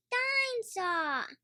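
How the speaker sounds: put-on a tiny boy happily naming his toy; George voice. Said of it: "says George".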